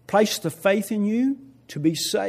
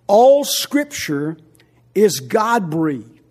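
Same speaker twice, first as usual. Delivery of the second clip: All Scripture is God breathed, loud and very slow; emphatic slow proclamation; 10 dB louder, much slower than usual. Shown as a demonstration. Pastor proclaiming the verse to the church, loud and slow. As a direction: proclaim each word with weight.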